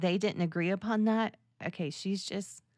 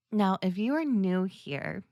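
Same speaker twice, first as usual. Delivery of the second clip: ordinary talk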